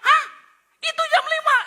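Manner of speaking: high, loud shocked protest; comic disbelief. Acted. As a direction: insist in disbelief. Husband shocked at the time. (tidak percaya, nada tinggi)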